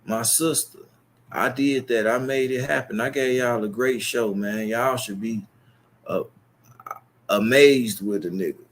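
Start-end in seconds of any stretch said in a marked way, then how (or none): none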